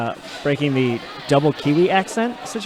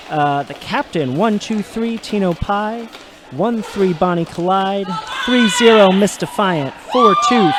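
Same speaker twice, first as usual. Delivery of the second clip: higher-pitched line-up call, punching the numbers; roller derby announcer; 4 semitones higher, 4 dB louder, slower than usual. Real, not acted. Announcer presenting the challengers Smash Malice, rousing roll-call delivery. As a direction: introduce each challenger with a lift on the number.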